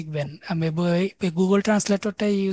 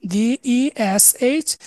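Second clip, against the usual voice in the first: slow spelling; 4 semitones higher, much slower than usual. Shown as a demonstration. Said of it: "ইংরেজিতে যখন বানালে কি".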